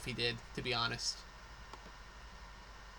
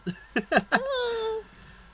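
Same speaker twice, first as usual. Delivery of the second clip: shrieking high laugh; 4 dB louder than usual. Real, not acted. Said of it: "laughs at own turtle voice".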